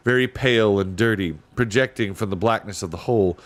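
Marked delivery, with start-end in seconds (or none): none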